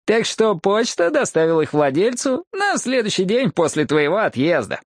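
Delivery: high, pleased rival's voice; Джим Брэкстон. Acted. Rival tells of his wedding and the watch, high gleeful voice. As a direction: chatter on high, pleased with yourself.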